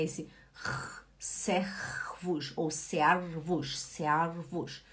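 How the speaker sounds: servus whispered with a soft vowel R, searvos; teacher whispering the right sound; whispered pronunciation. Shown as a demonstration. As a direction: whisper servus, let the R melt into A. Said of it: "ele não faz esse som do nosso R".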